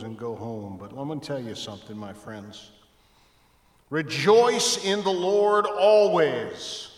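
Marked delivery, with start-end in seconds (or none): 3.9-6.9 slow, loud, high proclamation of rejoice; emphatic proclamation. Shown as a demonstration. Pastor quoting Paul to the congregation, slow and loud. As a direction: preach the verse out big. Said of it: "Paul says".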